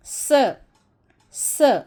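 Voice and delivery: "स" as a short hissed sa, not "es"; letter sound s. Shown as a demonstration. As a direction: sound out "स" crisply. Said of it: "आप इसे पढ़ेंगे स".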